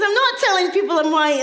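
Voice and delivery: loud, high-pitched mock indignation; indignant denial. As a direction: act out a brother protesting loudly. Brother's indignant denial, retold.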